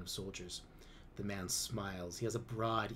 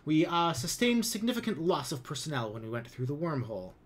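higher, louder blustering excuse; pompous commander; 5 semitones higher, 6 dB louder than usual. A narrator's reading. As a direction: cover the awkward truth with bluster. Lum justifies his command.

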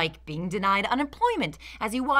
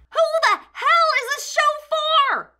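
high, loud, fed-up cry to the heavens; exasperated outburst; 6 dB louder than usual. Retold or imitated. Critic asking himself who the show is for, exasperated shout. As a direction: throw up your hands and yell it. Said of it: "I started to ask myself".

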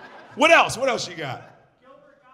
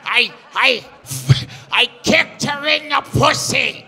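much higher, shouted, slow stand-up screech; Gilbert Gottfried impression; 9 semitones higher, 4 dB louder than usual. Comic imitating Gilbert's stage voice for the crowd, high shouted screech. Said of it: "You know how you be like".

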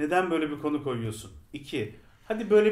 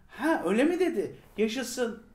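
lightly higher, naive cheerful yay; mock cheery Doctor; 6 semitones higher than usual. Fan mocking the Doctor's cheerful reaction, lightly voiced glee. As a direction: play the Doctor gleefully clueless. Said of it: "Böyle yapıyor doktor".

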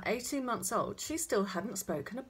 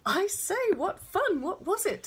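excited, high and louder exclamation; delighted surprise; 11 semitones higher, 6 dB louder than usual. A narrator's reading. Father hearing of his baby's first word, excited. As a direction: pitch leaps up with eager interest.

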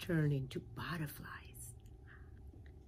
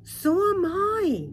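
much higher, louder proud squeak; delighted little pollywog; 14 dB louder than usual. Acted. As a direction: boast it brightly.

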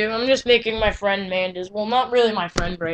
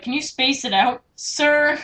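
higher, louder on a drawn-up "sir"; mock-polite taunt; 5 semitones higher, 5 dB louder than usual. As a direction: needle him with exaggerated formality. Taunting the friend in chat.

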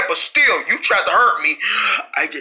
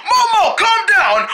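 high, strained holler; exasperated yell; 12 semitones higher, 3 dB louder, slower than usual. Acted. Telling the freaked-out turtle to settle, exasperated yell. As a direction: yell to bring him under control.

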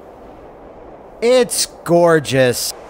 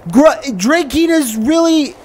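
put-on whiny man-child; 11 semitones higher than usual. Voicing drunk husband.

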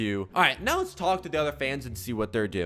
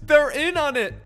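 shocked realization; 11 semitones higher, 6 dB louder than usual. Real, not acted. Realizing the fans know the truth.

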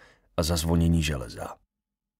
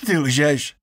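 loud, higher angry outburst; roaring; 8 semitones higher, 6 dB louder than usual. A narrator's reading. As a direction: yell the accusation at the grave. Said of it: "zařval Lermus". (zařvat nahlas a vztekle)

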